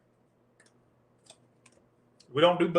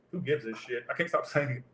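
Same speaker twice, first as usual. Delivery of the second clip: muttered aside; 2 semitones lower, 8 dB quieter than usual. Real, not acted. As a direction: toss it away under your breath. Reader's muttered aside mid-read.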